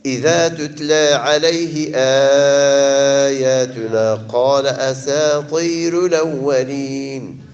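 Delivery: slow chanted recitation of ويل يومئذ للمكذبين; Quran recitation. Shown as a demonstration. Teacher recites for students, slow and measured. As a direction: recite it slowly, as tajwid practice. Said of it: "Quran recitation by teacher".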